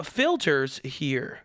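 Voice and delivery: usual speaking voice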